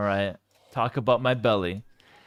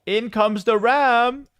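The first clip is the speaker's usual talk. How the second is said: drawn-out, higher, louder hype call; excited call; 8 semitones higher, 4 dB louder, much slower than usual. Real, not acted. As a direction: stretch it out like a big play call. Caster hypes the ram arriving.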